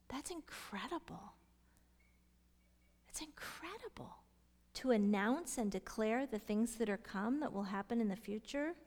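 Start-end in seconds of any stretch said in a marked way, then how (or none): 0.1-4.2 whispered amazement, much softer and higher; awed hush. Real, not acted. Teacher marvelling at the Spirit passing on the Father's words, hushed awe. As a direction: say it under your breath in wonder. Marvelling at what was read.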